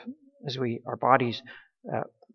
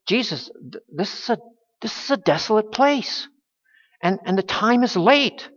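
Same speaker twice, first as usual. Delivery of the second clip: higher, exasperated complaint to Jesus; frustrated disciples; 6 semitones higher than usual. Retold or imitated. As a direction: voice the tired disciples' irritation. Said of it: "you can hear the, that frustration".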